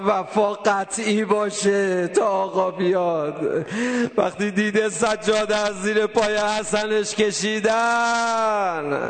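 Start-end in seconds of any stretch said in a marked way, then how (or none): 4.2-9.0 half-sung, long drawn-out ending; mourning lament. Real, not acted. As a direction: chant it slowly and let the last word wail out. Chanted lament on last word.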